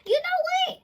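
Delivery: very high, laughing put-down; high mocking retort. Reader mocks a negative Nancy in a high, laughing voice. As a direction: squeal it out laughing. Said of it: "look at this person and go".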